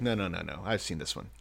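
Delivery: low, soft brush-off; dismissive Pharaoh. Acted. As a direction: wave it away, mock unimpressed. Acting unimpressed Pharaoh.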